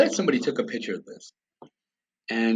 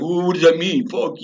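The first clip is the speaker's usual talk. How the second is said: frustrated outburst; 7 semitones higher, 6 dB louder than usual. Retold or imitated. Frustrated guys after vague meeting.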